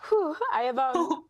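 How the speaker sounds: real tears breaking through, voice cracking high; choked up. Real, not acted. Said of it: "it's so emotional".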